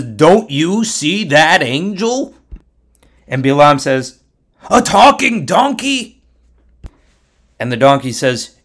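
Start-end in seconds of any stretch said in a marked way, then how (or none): 0.0-2.3 slow, higher, loud donkey character; drawn-out, indignant donkey question; talking donkey. Acted. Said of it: "the donkey turns to Bilam and says". 4.7-6.2 high, loud disbelief; astonished outcry. Acted. Bilam astonished that donkey talks.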